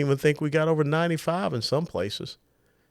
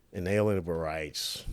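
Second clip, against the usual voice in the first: normal talking voice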